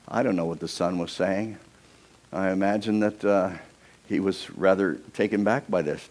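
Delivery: ordinary talk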